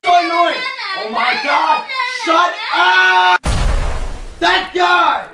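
annoyed yelling. Acted. Annoyed outburst.

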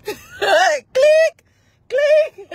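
high click through laughter; laughing click. Pretend. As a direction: click while cracking up. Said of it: "when I do the phone calls".

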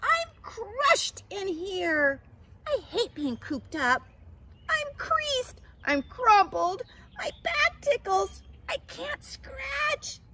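very high, loud theatrical whine; hammed-up caterpillar tantrum; whiny complaining. A narrator's reading. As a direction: wail every grievance, building to a drawn-out cry. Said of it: "complained Katisha".

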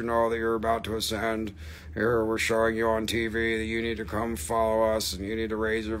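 low, monotone alien transmission. Pretend. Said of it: "That was my alien voice going through".

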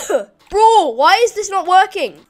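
loud, high frustrated complaint; exasperated outburst. Real, not acted. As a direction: burst out at the broken game. Complains game item fails.

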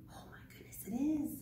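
breathless excited whisper; hushed delighted whisper. Real, not acted. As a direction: whisper so you don't scare him away. Whispering spotting leprechaun.

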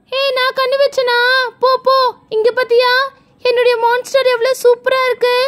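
put-on high-pitched child bragging back; indignant little girl. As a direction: retort in a high, boastful girlish voice. (வீம்பு பேசும் சின்னப் பொண்ணு குரல்)

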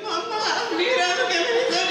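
put-on high, fast, whiny child; a kid sobbing to his grandma; crying nephew. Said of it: "he was like".

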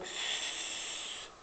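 a lone unvoiced s hissed; just hiss the s. Shown as a demonstration. Teacher isolating the snake s sound. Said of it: "on entend le serpent".

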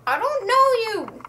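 mock bewildered outburst. Liz to forgotten best friend, mock bewilderment. Mimicking Liz after memory wipe.

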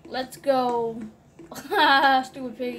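ordinary talk

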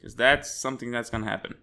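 normal talking voice

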